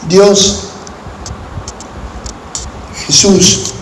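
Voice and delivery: normal talking voice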